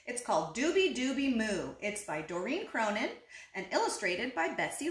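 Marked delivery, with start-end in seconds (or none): none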